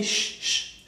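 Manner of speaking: a breathy unvoiced sh sound; whispered sh. Shown as a demonstration. Teacher isolating the CH sound for students, breathy sh. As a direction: hiss a soft sh for the CH. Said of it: "y la C H".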